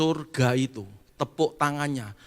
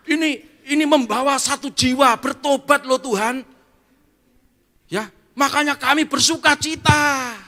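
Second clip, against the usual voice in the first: joyful angel; 12 semitones higher, 8 dB louder than usual. Acted. Angel explains joy to Jesus.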